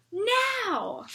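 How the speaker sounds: high sudden command; sharp call. Acted. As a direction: call the pull. Signal to pull together.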